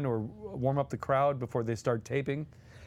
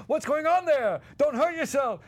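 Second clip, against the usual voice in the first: much higher, louder call from a trailer; mock shout; 11 semitones higher, 5 dB louder than usual. Dom heckling the warm-up act from his trailer, loud call. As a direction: holler it across the set. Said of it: "Dom would always shout".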